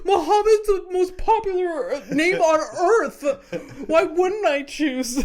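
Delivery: high, loud, know-it-all nerd voice; hammed-up confident geek; McLovin quote. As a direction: play the smug nerd defending his name. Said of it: "McLovin just like".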